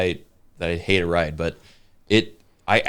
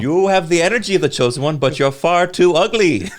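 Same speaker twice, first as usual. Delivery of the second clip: mock Ollivander; 9 semitones higher, 5 dB louder than usual. Ollivander insulting a fan, high and snide. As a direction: sneer it out, up high, laughing. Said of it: "S2 joins as Ollivander".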